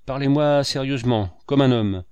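loud, scornful dismissal with a smile. A narrator's reading. Landlord answers the painter's offered fresco, with scornful contempt. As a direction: brush him off with contempt. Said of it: "avec un sourire de mépris".

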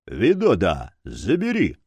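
firm, understated order; commanding. A narrator's reading. Дор orders Видода to take the cards, commanding. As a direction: give a curt order to an underling. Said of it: "после чего скомандовал".

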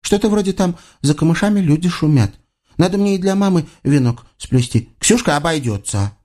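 put-on high-pitched child's chatter; lightly voiced small girl; little girl. A narrator's reading. Little girl prattles to herself.

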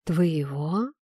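low, hushed question with a rising lilt; mocking murmur. A narrator's reading. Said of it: "шепнула насмешливо река".

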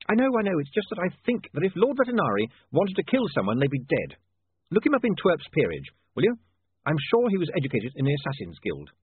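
high, hurried-sounding insistence; exasperated William. Acted. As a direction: argue your reasoning out loud. William argues with Saccharissa.

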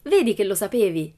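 gotcha, you knew it; exclaiming. Acted. Pippi catching out the teacher, triumphant exclamation. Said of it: "esclamò Pippi".

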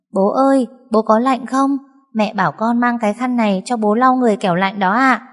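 put-on high caring child's voice; little girl's voice. Daughter brings towel to her cold father, high child voice. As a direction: ask sweetly like a small daughter.

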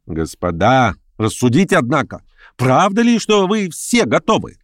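exasperated political orator; agitator scolding his listeners. Acted. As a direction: rebuke the whole room, then challenge them.

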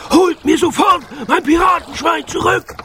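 high, furious captain's yell; Knurrhahn ranting. A narrator's reading. Pirate captain wants his pig back, ranting. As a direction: demand it in a strained, very high yell. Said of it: "zeterte Knurrhahn".

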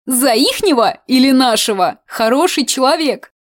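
pry eagerly like a village aunt; nosy, happy old woman. Acted.

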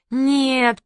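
high, drawn-out whiny "no"; acted childish wail; crying refusal. A narrator's reading. Little Gayane refusing granny, high crying whine. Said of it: "плакала Гайне".

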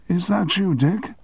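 high, thin sick man's question; reedy voice. Acted. Sick deputy answering the phone, reedy. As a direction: sound weak and reedy. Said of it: "a reedy voice asked".

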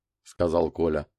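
ordinary delivery, nothing marked

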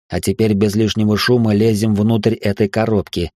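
ordinary delivery

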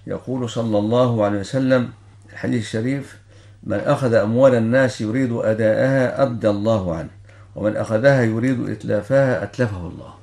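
ordinary talk, nothing marked

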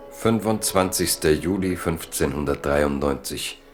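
ordinary delivery

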